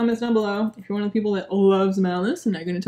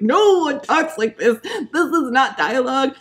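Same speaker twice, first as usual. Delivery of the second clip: slow, laughing, higher; laughing disbelief; 3 semitones higher, 3 dB louder than usual. Real, not acted. Reviewer laughing at the clunky dialogue, disbelieving. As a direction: laugh through the complaint.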